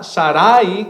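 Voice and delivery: "Sarai" as sa-RA-i, middle syllable stressed; wrong-way demo, "Sarai" accented in the middle; stress on second A. Shown as a demonstration. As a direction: lean long on the second a of "Sarai". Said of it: "con acento en la segunda A".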